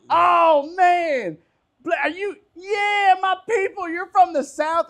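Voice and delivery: high, loud, whooping Southern buddy; excited shout. Retold or imitated. Dustin thrilled to meet a fellow Southerner, shouting high and loud. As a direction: whoop it out like you found your people. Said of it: "And Dustin got excited".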